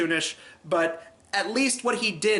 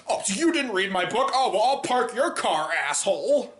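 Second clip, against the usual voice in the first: hammed-up sarcastic retort, pitched higher; overdone snide valet line, played for laughs; hammy over the top; 4 semitones higher than usual. Reviewer imitating the valet's hammy sarcastic comeback. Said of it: "like, just over the top, hammy delivery".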